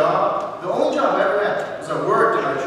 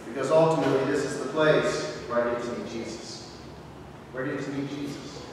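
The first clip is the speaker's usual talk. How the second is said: sincere, softening; 5 semitones lower, 7 dB quieter than usual. Real, not acted. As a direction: lower and softer, heartfelt. Sincere climax, then softer echo.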